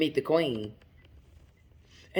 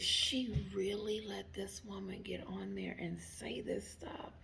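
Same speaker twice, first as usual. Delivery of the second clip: scandalized half-whisper, much softer; hushed shock; 3 semitones higher, 10 dB quieter than usual. Retold or imitated. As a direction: whisper it like juicy, unbelievable trouble. Retells own hushed shock at Oprah.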